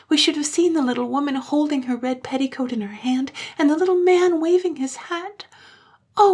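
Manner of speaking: put-on high-pitched, loud child voices; wishful, high childlike pleading; wistful children. A narrator's reading. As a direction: lament like children who missed out. Disappointed children wishing aloud in story.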